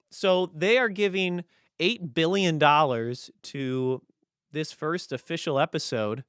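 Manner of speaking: ordinary delivery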